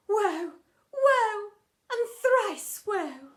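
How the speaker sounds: ordinary delivery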